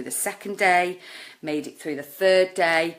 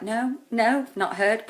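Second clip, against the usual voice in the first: higher and a bit louder, breezy pretend denial; mock-cheery denial hiding heartbreak; fake cheerful denial; 6 semitones higher, 3 dB louder, slower than usual. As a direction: put on a brave front. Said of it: "putting on that front".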